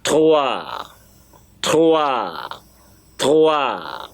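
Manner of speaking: "Trois" said "twa", with no final s; model word trois. Shown as a demonstration. Teacher drills trois for the class. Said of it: "you have to learn is trois".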